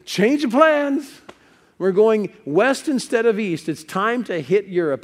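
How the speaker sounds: high, loud rallying call, easing as it goes; excited announcement. Acted. Paul waking after the night vision, excited announcement. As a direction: call out the new plan with gusto.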